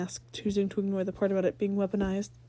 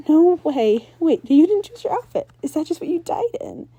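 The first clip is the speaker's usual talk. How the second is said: much higher, much louder disbelief; shocked; 8 semitones higher, 10 dB louder than usual. Acted. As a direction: blurt out in shock. Ember shocked.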